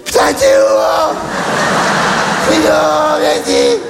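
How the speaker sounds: put-on slurred drunk yelling into the night; drunk shout. Drunk guy outside the club yelling for his car. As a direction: bellow for the lost car like a drunk.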